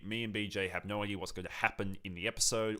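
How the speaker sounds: ordinary talk, nothing marked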